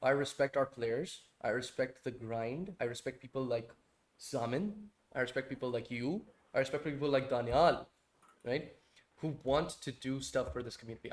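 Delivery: slow, low, soft and heartfelt; quiet, measured sincerity; sincere tribute. Real, not acted. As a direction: speak gently and earnestly, weighing each phrase. Heartfelt tribute to the community's players.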